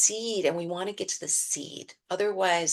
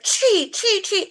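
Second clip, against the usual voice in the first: tree barked high and loud; angry labeling; 14 semitones higher, 12 dB louder, slower than usual. Retold or imitated. Told to label the tree but full of rage, loud. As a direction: label the tree while seething. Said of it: "Because we're filled with anger".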